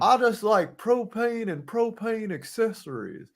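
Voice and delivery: a hint of a Texan dad; deadpan propane salesman; Hank Hill. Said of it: "you have the mannerisms down".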